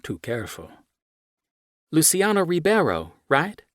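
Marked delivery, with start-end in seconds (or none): 1.9-3.6 put-on higher, bright-eyed American girl asking; young American woman. Young American writer greeting the footballer, higher and eager. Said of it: "she says to me in an American accent".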